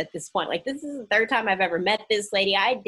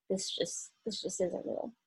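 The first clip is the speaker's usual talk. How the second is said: much softer, under her breath; self-talk in denial; 9 dB quieter than usual. Retold or imitated. Woman telling herself in denial, very softly. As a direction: murmur it to yourself.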